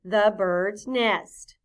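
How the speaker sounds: drawn-out choral reading; slow choral repeat. Shown as a demonstration. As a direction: say it together, very slowly. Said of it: "Say that with me".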